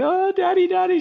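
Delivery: high, wailing mock sob; crying child. Fallen daughter running to daddy, pretend crying. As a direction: imitate a little girl bawling for her dad. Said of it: "She's crying".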